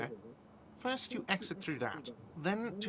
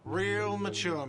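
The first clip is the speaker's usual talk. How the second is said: slow, higher, louder whiny jeer; whiny shout; 5 semitones higher, 4 dB louder, slower than usual. Acted. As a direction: drag out Real mature like a sulky whine. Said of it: "a whiny voice shouts".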